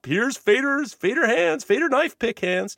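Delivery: high-pitched mocking taunt; winding someone up. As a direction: imitate people goading him. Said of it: "trying to wind me up".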